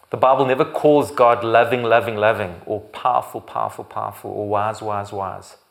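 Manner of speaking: loud, driving pulpit emphasis; emphatic preaching. Acted. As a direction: hammer the point home.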